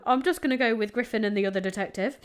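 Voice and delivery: ordinary talk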